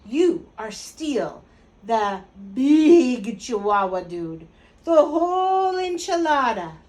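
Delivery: slow, loud mock Spanish accent; hammed-up Spanish accent, stretching each phrase. A narrator's reading. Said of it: "using his very best Spanish accent".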